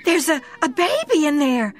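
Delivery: high, loud stammering cry of alarm; shocked scream. A narrator's reading. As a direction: blurt it out horrified, tripping on the words. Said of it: "screamed Laura".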